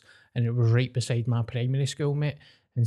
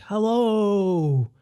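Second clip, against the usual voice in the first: cheery hello; 7 semitones higher than usual. Retold or imitated. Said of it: "he was just like".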